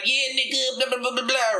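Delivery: high-pitched, hammed-up homie voice; crazy little homie. Comic previewing his roast persona, crazy little homie voice. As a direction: babble in a high cartoonish homie character. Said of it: "come in as a crazy little homie".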